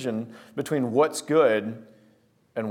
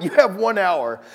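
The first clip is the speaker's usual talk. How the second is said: acted urgent warning; 5 semitones higher, 4 dB louder, slower than usual. Urgent answer to panicking friend.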